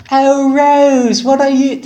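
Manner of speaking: high-pitched, shaky attempt at a southern woman; Blanche's southern accent. Blanche to Rose on the roadside, in a hammed-up high southern accent. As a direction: put on a high southern accent, badly faked. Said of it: "harder to do a woman's southern accent".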